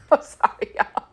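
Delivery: high, soft, voiceless laughing words; real laughing apology. Real, not acted. Reader saying sorry to listeners, laughing helplessly. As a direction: squeeze an apology out through laughter. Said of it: "I don't know why I'm laughing".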